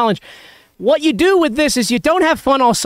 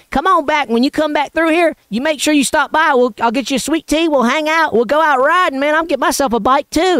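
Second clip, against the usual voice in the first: Southern neighbor invitation; 5 semitones higher than usual. Retold or imitated. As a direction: invite him back warmly in a rural Tennessee accent. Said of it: "he goes".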